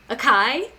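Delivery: "Acai" said as uh-KAI, hard k, no s sound; "Acai", the k said hard like kite; mom's mispronunciation. Retold or imitated. Imitating mom asking about acai, "Acai" as uh-KAI. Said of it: "This is how she says it".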